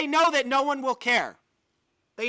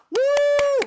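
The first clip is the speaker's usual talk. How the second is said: loud high sarcastic whoop; mock whoop; 9 dB louder than usual. Real, not acted. Cheering sarcastically at the hypocrisy, loud high whoop. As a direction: whoop it up mockingly.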